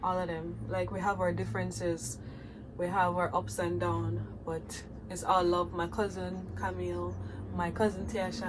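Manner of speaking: ordinary talk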